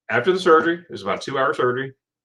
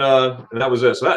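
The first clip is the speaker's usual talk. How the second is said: ordinary talk, nothing marked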